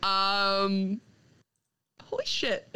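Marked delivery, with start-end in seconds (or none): 2.0-2.8 high, breathless disbelief; stunned. Real, not acted. Fan stunned by the ending, breathless laugh. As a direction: gasp it out in amazement. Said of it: "reacting to the issue's ending".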